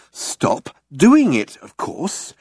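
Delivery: brightly spelling out the obvious; bright but slow. A narrator's reading. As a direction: offer it brightly, word by word. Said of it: "brightly but slowly".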